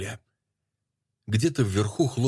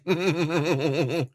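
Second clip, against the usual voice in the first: scornful laugh; 7 semitones higher than usual. Acted.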